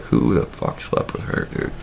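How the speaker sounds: hushed whisper aside; whispered aside to himself. Retold or imitated. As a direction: say it under your breath, stunned. Muttering at school pickup.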